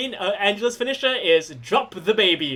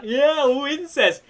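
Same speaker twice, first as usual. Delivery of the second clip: high, slow, drawn-out cheer; gleeful crowd-working; 6 semitones higher, much slower than usual. Acted. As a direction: egg the crowd on with a long, high shout. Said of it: "You're like".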